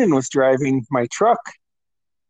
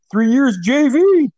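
high, drawn-out mock holler; imitating a friend's heckle; imitated taunting cry; 9 semitones higher, 4 dB louder, slower than usual. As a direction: call it out high and slow, teasing. Imitating friend's repeated mocking cry.

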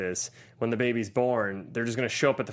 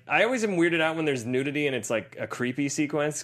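ordinary delivery

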